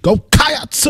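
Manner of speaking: high cheer with a preacher's lilt. Shown as a demonstration.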